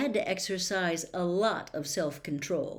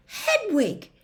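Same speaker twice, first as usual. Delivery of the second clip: high, loud delighted gasp of a name; startled, joyful exclamation; gasped surprise; 11 semitones higher, 5 dB louder than usual. A narrator's reading. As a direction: cry out in sudden surprise. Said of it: "Harry gasped".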